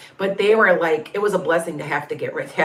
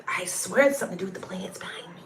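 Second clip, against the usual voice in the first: fast soft whisper; hushed, quick confiding whisper; whispered aside; 6 dB quieter, faster than usual. Real, not acted. Conspiratorial aside about orbs.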